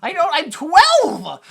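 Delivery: high, loud, drawn-out protest; mock kid outburst. Twelve-year-old protesting to mom, loud mock outburst. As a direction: wail it slowly like an upset boy.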